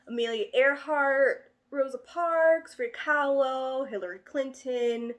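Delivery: slow loud name call; proud announcing. Real, not acted. Reading names proudly.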